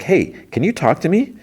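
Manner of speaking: high-pitched imitated plea for attention; retold insistent appeal. Lady worker to conductor who keeps walking, retold high insistent call.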